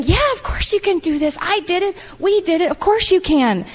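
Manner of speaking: insistent, upbeat reassurance; encouraging. Retold or imitated. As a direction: re-enact urging a teammate on. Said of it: "I love encouraging you".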